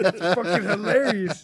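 cracking up while talking; laughing. Real, not acted. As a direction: laugh it out, high and loud. Laughing at own story.

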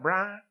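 Brian squashed into one syllable, "Brah". Retold or imitated. Locals greeting the preacher by name, one-syllable Brian. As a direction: say Brian as a single drawn-out "Brah". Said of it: "turning single syllable words into double syllable words".

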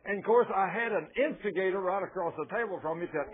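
usual speaking voice

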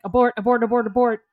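loud, urgent repeated warning; acting out a hurried escape order; urgent retreat call. Friend urgently pulls her from party.

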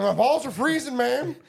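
put-on high, loud old man gripe; old man complaining. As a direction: whine like a cold old man. Mock Biden complaining.

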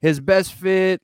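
normal talking voice

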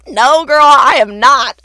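high, loud denial breaking into laughter; loud mock protest. Employee correcting her boss, loud amused protest. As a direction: push back loudly, half laughing.

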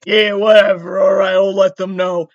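loud, monotone cop impression; dismissive cop. Police officer fobbing off a caller, dismissive and loud. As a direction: brush them off like a bored officer. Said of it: "the cop is like".

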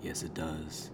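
low, soft seductive murmur. Real, not acted. Co-host backing the moustache joke, seductively. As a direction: lean into the microphone, low and sultry. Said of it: "why are you talking so seductively".

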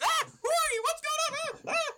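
extremely high goblin shriek; panicked, piercing goblin squeal. Acted. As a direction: shriek in alarm between squealed questions. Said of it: "like, shrieks".

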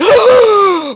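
a big high gasp of joy; excited gasp. Retold or imitated. Little son hears he plays soccer today, gasping in delight. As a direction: gasp high and loud like a thrilled kid.

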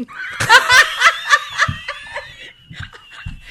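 loud, high cackling laughter; real belly laugh. Real, not acted. Listener cracking up at a story of a swearing child, real laughter.

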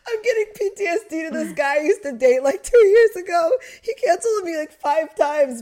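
high, loud, laugh-cracked telling; laughing while talking. Real, not acted. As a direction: tell it through laughter.

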